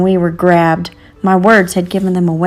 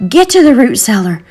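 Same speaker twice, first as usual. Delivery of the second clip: put-on raised, higher urgent command from an old woman; 6 semitones higher, 5 dB louder than usual. A narrator's reading. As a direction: push them to hide, loud and firm. Momo Bay urges children to hide.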